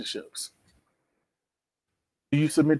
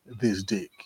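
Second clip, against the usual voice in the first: ordinary talk, nothing marked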